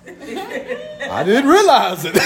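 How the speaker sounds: high, stretched-out feigned innocence with laughter; laughing mock innocence. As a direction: play clueless and crack up. Acts sheepish reply, laughing.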